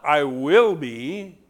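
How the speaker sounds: "I will be" slowed and drawn out; slow contrast. Shown as a demonstration. Preacher slowly contrasting the wrong phrase. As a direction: drag out "I will be" as the wrong reading. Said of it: "I not".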